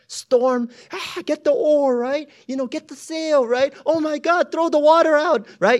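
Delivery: very high, loud frantic calls; panicked. Acted. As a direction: panic as the boat floods. Acting disciples panicking in storm.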